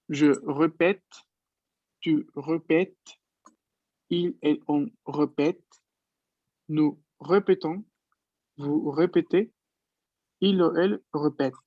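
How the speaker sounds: slow, careful French reading of répète; French conjugation read aloud. Shown as a demonstration. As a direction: model each form of répéter clearly. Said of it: "Entonces yo aquí esto lo leo". (lectura lenta y clara de la conjugación en francés)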